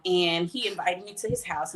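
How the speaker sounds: ordinary delivery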